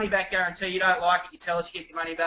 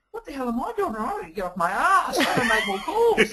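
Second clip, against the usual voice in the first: laughing self-rebuke; 7 semitones higher than usual. Retold or imitated. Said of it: "I'm thinking".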